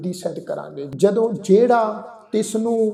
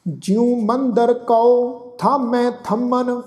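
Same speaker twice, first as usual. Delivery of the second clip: slow, devout chanting, each phrase drawn out; gurbani recitation; 3 semitones higher, much slower than usual. Acted. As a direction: chant the verse with reverence.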